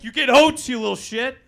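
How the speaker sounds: loud angry mock dad; drunk dad yell. Comic voicing a tipsy dad yelling at his kid, loud mock anger. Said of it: "tipsy dad that would just start throwing haymakers".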